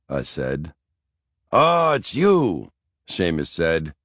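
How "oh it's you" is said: put-on high, slow old man's recognition; old Irish grandfather. A narrator's reading. Grandfather recognizes his grandson on the phone, old man voice. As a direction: draw it out like a crotchety grandpa.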